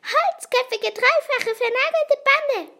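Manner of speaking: high, loud creature voice; jeering, high-pitched insult; gleeful scorn. A narrator's reading. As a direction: hurl the name-calling in a high voice. Fliegenbart mocks the pursuers.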